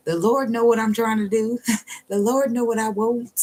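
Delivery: high, sing-song mock prayer; mock pious prayer. Mocking religious people praying, high and sly. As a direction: play a manipulative churchgoer, high and sly. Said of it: "'cause you'd be like".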